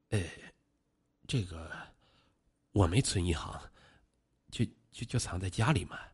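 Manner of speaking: soft, halting low voice of a cornered suspect; nervous evasion. A narrator's reading. Suspect dodging a policeman's question about the money, nervously evasive. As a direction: falter quietly, stalling between words.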